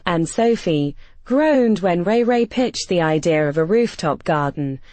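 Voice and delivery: usual speaking voice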